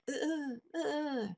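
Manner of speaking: high, soft stuttering grunts; faint high-pitched "uh, uh" sputters; dying squirrel gasps. Retold or imitated. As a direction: mimic a twitching, dazed squirrel. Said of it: "I see the squirrel going".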